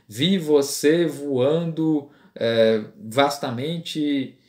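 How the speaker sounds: very slow, each V of "vi você voando" and "vastamente" pushed out; stressing the V sounds. Shown as a demonstration. Teacher making up an example of repeated consonants, very slow. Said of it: "Por exemplo".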